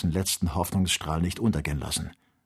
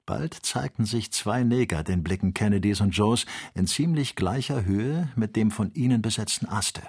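ordinary delivery, nothing marked

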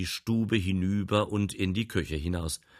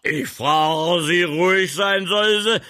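shouting; 10 semitones higher, 10 dB louder than usual. Acted. Quarrel, he shouts her down.

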